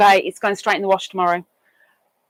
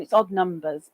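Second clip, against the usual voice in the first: ordinary talk, nothing marked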